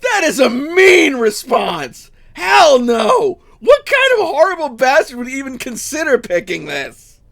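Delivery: loud, high shouting mock disbelief; hammed-up shock at the news; mock outrage. Apprentice joshing Joanne's new girlfriend with loud mock shock.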